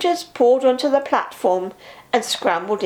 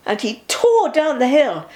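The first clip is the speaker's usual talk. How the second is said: usual speaking voice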